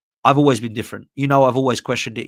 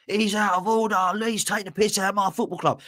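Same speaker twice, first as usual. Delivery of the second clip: mock irate fan; 7 semitones higher than usual. Fan furious about his club, mock outraged and high. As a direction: put on the irate fan, pitched up and dragged out. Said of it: "The fans will get irate".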